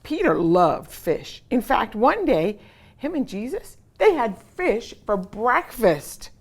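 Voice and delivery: ordinary delivery, nothing marked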